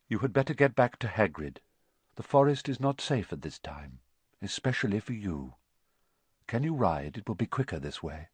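soft, quietly urgent centaur; understated warning, kept low; a hint of a solemn centaur guardian; lightly voiced grave centaur. Firenze urges Harry to safety.

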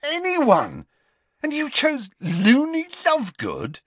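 high, disbelieving outburst; incredulous. A narrator's reading. Ron to Harry over dinner, high disbelief. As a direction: scoff at his choice in disbelief.

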